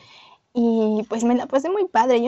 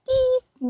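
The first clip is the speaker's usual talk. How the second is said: high, sharp mouth beep sound; censor beep. Pretend. Censoring Rosita's name to friends with a high beep sound. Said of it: "Voy a, voy a censurar tu nombre".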